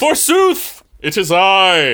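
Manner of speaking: booming mock-heroic knight; hammy stage actor. Knight announces himself.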